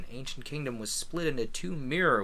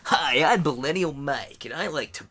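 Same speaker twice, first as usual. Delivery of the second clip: loud goofy dude-bro voice; Millennial Mike voice; 2 semitones higher, 7 dB louder than usual. Pretend. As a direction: hype it up like a bro. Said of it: "my, uh, Millennial Mike voice".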